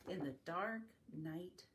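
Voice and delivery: ordinary delivery, nothing marked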